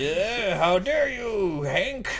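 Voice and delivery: put-on high, slow, drawn-out petulant kid; angry kid voice. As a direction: whine it like a sulking child. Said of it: "this kid, he's getting angry".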